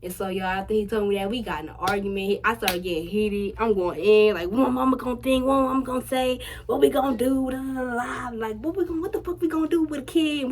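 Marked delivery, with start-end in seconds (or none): 4.5-10.5 high, rising panicked questions; panicked rant. Retold or imitated. Scared teen asking boyfriend what mom will say, panicked. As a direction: spiral into panic, higher and higher. Argument after pregnancy scare.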